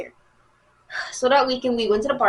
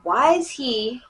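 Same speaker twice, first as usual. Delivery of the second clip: usual speaking voice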